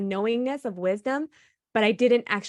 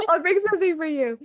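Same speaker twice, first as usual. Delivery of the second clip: higher, singsong mock-sweet promise; playful teasing promise; 8 semitones higher, 4 dB louder, faster than usual. Teasing her partner about bringing him something, higher and playful. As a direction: tease him with a coy repeat of the promise.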